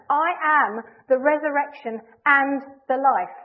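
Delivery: slow, loud, absolutely determined declaration; emphatic. Shown as a demonstration. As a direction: proclaim it with full conviction. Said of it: "He's emphatic".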